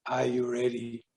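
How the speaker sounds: soft, low question; inner divine voice. Retold or imitated. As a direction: imitate a quiet voice speaking within. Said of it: "this voice says to me".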